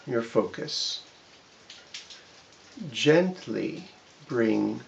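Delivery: slow, measured meditation guidance; walk the listener gently through each part, pausing often; drawn-out relaxation instructions with long pauses; guided body scan. Shown as a demonstration.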